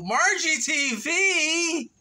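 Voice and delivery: high, drawn-out call of the channel name; sing-song callout. Real, not acted. Vlogger hailing her viewers, high and stretched. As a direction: stretch it out like a greeting.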